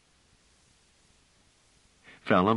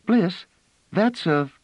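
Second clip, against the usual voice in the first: put-on high, eager child's voice; a small girl tugging at an arm; childlike and excited; little girl voice; 14 semitones higher, 5 dB louder, slower than usual.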